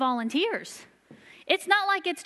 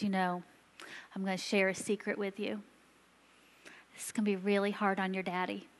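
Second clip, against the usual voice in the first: put-on hushed and soft, confiding to a child; gentle secret told to a little girl, softer; sharing a secret; 6 semitones lower, 8 dB quieter, faster than usual. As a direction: lean in and tell her a secret quietly. Telling sick girl a secret.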